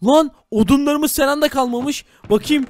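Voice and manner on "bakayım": normal talking voice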